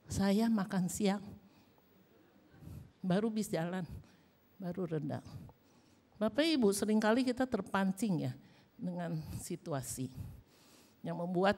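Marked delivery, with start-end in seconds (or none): none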